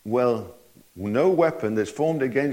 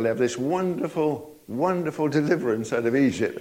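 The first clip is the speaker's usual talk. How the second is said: ordinary delivery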